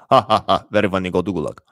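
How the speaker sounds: mocking dictator laugh, then a low, dismissive order; Stalin. Stalin mocks a joke and banishes the teller to the Gulag, low and sneering. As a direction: play a scornful tyrant sending someone off. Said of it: "Stalin, R J J, Stalin".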